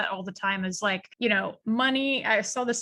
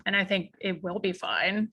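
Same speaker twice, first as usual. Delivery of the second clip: ordinary talk, nothing marked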